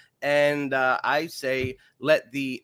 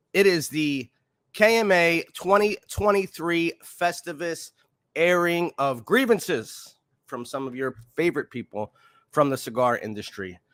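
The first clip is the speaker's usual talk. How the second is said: ordinary talk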